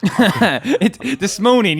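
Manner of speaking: high, loud laughing tease. Real, not acted. Co-host teasing his friend about this morning, laughing. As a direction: start the tease through laughter.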